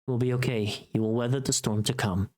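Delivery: usual speaking voice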